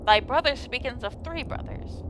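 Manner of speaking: solemn faux-archaic fairy-tale speech; lightly voiced medieval drama; mock Old English. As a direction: play it like an old legend, grave and formal. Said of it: "And very serious".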